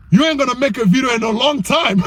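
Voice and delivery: breaking into a laugh; laughing at your own joke; amused. Real, not acted. Laughs at his own warning.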